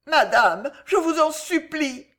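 supplication. A narrator's reading. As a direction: implore her with the voice rising high. Alice begs the queen.

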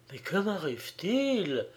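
soft, broken murmur; crushed murmur. A narrator's reading. Girl murmurs, crushed by his cruel words. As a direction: murmur it, devastated. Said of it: "murmura Béatrix anéantie".

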